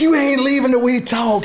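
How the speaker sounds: high, loud mock yell; retold angry yell. Confronting his wife after the crash, a retold yell. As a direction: replay the road-rage demand, loud. Acted road rage quarrel.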